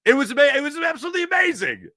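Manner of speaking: high, loud burst of pure joy; excited shout. Real, not acted. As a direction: shout it out, overwhelmed with delight. Remembering the Eagles Super Bowl win.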